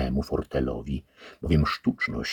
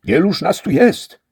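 wyżej i głośniej, pytanie Don Antonia; podniecone pytanie; 11 dB louder than usual. A narrator's reading. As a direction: pyta zdumiony. Antonio asks the head. (pytanie zaintrygowanego gospodarza)

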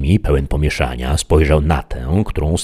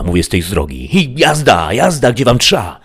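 angry peasant; 7 semitones higher, 3 dB louder than usual. A narrator's reading. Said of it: "rzekła".

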